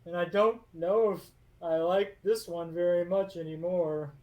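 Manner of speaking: high, loud mournful golem; a lonely stone guardian confessing; sad golem. Acted. Golem telling Lucia he has known no other life, high, loud and mournful.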